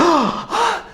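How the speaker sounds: sharp gasp of mock suspense; acted high gasp; crowd gasp. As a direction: suck in air like an awestruck crowd. Crowd gasps at the shot.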